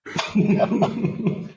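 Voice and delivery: short amused laugh at the punchline; real laugh. Real, not acted. Storyteller laughing at the wife's complaint, brief chuckle.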